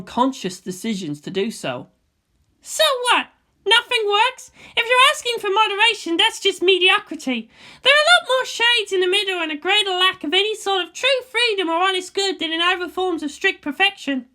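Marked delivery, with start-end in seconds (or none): 2.6-14.3 put-on higher, louder girl's voice for Asuka; a young girl arguing her point; Asuka's voice. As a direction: raise the voice to play the headstrong girl.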